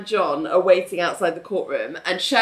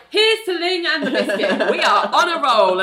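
mock toast; 8 semitones higher, 4 dB louder than usual. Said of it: "Richard's like".

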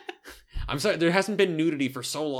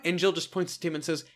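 usual speaking voice

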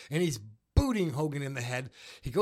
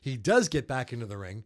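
ordinary delivery

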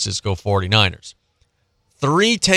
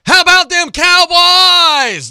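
high, loud, drawn-out holler; big hyped-up catchphrase yell; rallying cry; 7 dB louder, slower than usual. Acted. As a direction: whoop it out like a fired-up fan, stretching each word. Hyped catchphrase opening game recap.

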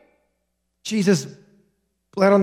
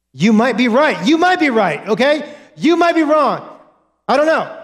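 emphatic preaching; 7 semitones higher than usual. Real, not acted. Preacher raises voice, emphatic point.

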